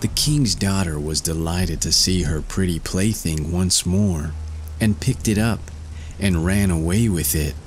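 ordinary delivery

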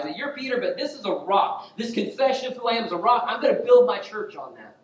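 high, loud, emphatic declaration; impassioned preaching. Acted. Jesus affirming Peter's confession, preached with impassioned force. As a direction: proclaim it forcefully from the pulpit.

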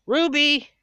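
high, loud call of her name. Real, not acted. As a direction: call the dog over. Calling the dog.

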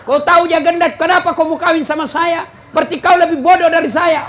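high, loud, laughing mock scolding; wife shouting back at her husband in a quarrel. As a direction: throw the insults back in a comic spat. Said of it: "Nabi bilang juga istrinya".

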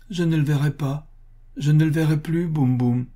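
put-on high, plaintive sick child; small high voice lamenting a lost friend; little child's voice. A narrator's reading. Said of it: "dont la petite voix parlait aux anges".

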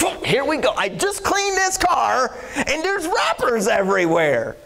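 husband groaning at wrappers in his clean car; mock exasperation. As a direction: play up the tired complaint with a laugh. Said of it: "I'll get in, like".

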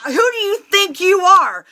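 loud, high-pitched yelling; indignant, explosive shouting; furious outburst. Retold or imitated. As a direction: tear into him at the top of your voice. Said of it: "I just blew up".

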